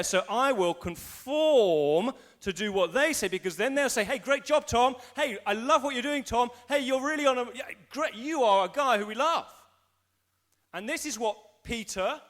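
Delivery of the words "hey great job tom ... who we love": high, loud, enthusiastic approval; gushing praise. Acted. People in the room praising Tom, acted gushing. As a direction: heap hammed-up praise on him.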